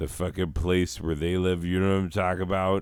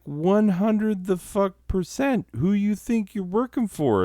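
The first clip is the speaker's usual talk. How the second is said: much higher, cocky put-on boss voice; hammed-up smug suit; smug corporate voice. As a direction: sneer it like a smug executive. Corporate voice quoted mockingly.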